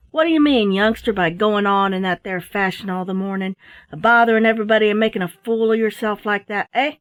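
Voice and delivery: put-on a rough jack-tar scolding the boy; old sea dog ticking off a youngster; gruff sailor. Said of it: "a gruff voice".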